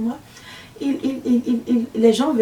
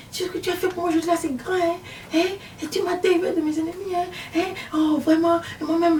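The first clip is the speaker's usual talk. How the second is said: flattering insistent woman; 6 semitones higher than usual. Retold or imitated. Witch coaxes the visionary to take money, higher and wheedling. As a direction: coax and flatter, keep saying hein.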